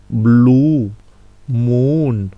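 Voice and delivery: "blue moon" with a slightly long, rounded "ou". Shown as a demonstration. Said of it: "Donc, ou". ("blue moon" avec un ou un peu long)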